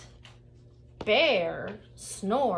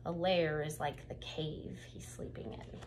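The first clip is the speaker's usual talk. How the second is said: low, soft whispered aside; whispered explanation; 6 semitones lower, 9 dB quieter than usual. Acted. Narrator explaining a word to children, whispered. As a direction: let the kids in on a secret.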